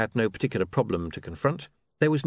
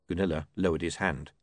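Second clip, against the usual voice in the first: ordinary delivery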